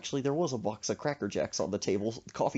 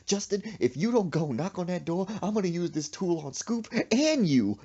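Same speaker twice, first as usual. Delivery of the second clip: high, raised-voice ultimatum, acted; threatening mama; 7 semitones higher, 4 dB louder than usual. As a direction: build to a loud threat. Mama threatening Justin in story.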